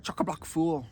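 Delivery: playful Australian slang delivery; Australian accent. Acted. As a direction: show off an Australian twang. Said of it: "Australian's more like".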